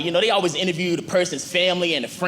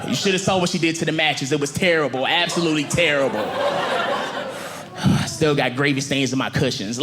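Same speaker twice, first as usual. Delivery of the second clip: lower, tired couch voice with sighs; weary couch; 5 semitones lower than usual. Acted. A couch telling the interviewer its troubles, lower and weary. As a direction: sigh through the complaint like a worn-out couch. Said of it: "that's what the couch would say".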